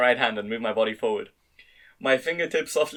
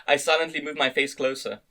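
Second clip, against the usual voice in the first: usual speaking voice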